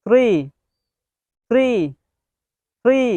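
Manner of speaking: three with a clean th; pronunciation demo. Shown as a demonstration. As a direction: model "three" slowly and clearly. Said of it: "Next one is".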